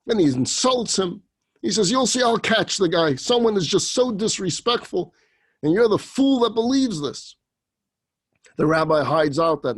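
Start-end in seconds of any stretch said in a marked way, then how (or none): 1.9-7.4 stern threat. Acted. Rabbi vows to catch culprit.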